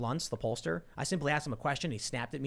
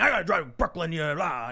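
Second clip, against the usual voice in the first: mock angry grumble, pitched up a touch; angry complaining. Cabbie griping about the drive to Brooklyn, mock angry. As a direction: imitate a cussing, complaining cabbie. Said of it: "He was angry, complaining".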